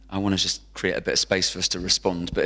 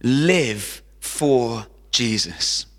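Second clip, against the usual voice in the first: very slow, every word weighted; slowly drawn out; much slower than usual. Real, not acted. Preacher urging the congregation, slow and weighted. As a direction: stretch out live for Jesus, x0.3 pace.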